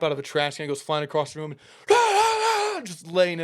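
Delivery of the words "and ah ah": high, loud, slow wordless yelling; hammed-up furious hollering noises; raging yell. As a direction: mimic a coach losing it, drawn-out shouts. Coach kicks trash can, raging.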